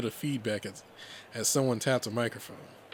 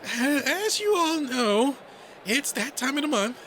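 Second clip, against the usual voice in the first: high, stammering nervous rabbit; nervous high-pitched announcement; timid announcer; 14 semitones higher, 4 dB louder than usual. Acted. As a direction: address the crowd shyly, pitched way up. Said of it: "The timid little thing".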